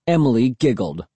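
plain reading voice

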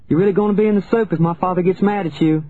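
high, quick warning call. A narrator's reading. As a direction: warn someone hiding, pitched high. Child calling to hidden cousin.

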